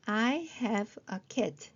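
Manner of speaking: slow model sentence, words closer together; slow joined-up reading. Shown as a demonstration. Teacher repeating the short sentence, slowly joined up. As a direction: read it slowly as one sentence.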